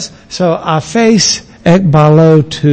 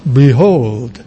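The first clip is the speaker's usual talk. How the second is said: mock solemn behold; 5 semitones lower than usual. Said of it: "it's not like".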